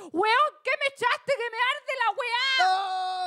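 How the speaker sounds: high-pitched panicked yell, drawn out; acted yelling. Woman yells at her lover as it burns, acted shout. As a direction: cry out as if it burns. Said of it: "Entonces le dije gritando".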